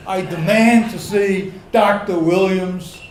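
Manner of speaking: loud commanding demand; authoritative outdoor voice. Retold or imitated. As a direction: boom it across the waiting room with authority. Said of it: "in my most authoritative outdoor voice".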